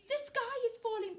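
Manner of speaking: high, panicked chick; mildly panting alarm. A narrator's reading. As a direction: gasp it out like a frantic chick. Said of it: "panted Chicken Licken".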